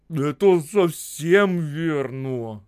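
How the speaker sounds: slow, deliberate, clearly articulated; calm and distinct. A narrator's reading. Будырин confirming the charge, calm and distinct. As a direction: say it slowly and firmly, every word clear. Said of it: "спокойно и внятно сказал Будырин".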